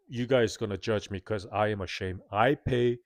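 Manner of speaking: normal talking voice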